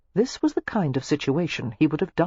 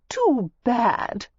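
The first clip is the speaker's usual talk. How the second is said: smug; 7 semitones higher than usual. A narrator's reading. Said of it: "she said".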